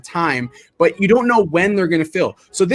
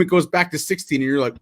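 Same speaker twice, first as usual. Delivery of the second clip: usual speaking voice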